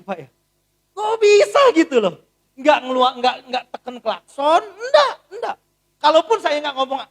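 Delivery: high, loud astonished gushing; loud, laughing admiration; amazed praise. Retold or imitated. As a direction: marvel at the pastor. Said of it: "saya langsung bilang".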